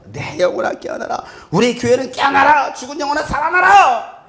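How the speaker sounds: loud sermon proclamation; proclaiming call. Shown as a demonstration. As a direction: build to a commanding cry. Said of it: "깨우는 거야".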